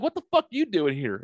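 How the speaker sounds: retold stunned greeting to an old friend; amazed question. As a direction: ask it half laughing in shock. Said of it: "I'm like".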